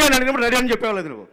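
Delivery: high, loud, rapid telling-off; scolding director. Retold or imitated. Director berates assistant for saying ready too soon, scolding. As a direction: mimic a boss snapping at an assistant.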